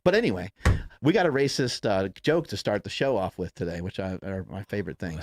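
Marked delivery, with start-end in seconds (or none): none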